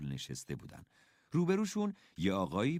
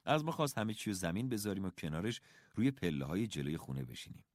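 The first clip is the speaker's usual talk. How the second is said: ordinary delivery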